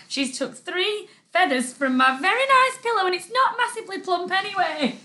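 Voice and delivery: high-pitched, put-out protest; playful indignation. Real, not acted. Host telling off co-host over cushion feathers, mock indignant.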